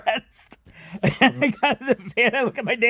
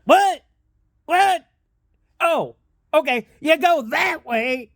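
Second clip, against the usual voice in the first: hard-of-hearing yelling guy; 7 semitones higher, slower than usual. Retold or imitated. Deaf stranger failing to hear, high mock holler. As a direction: imitate a deaf man hollering back. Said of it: "this guy's yelling at him 'cause we think".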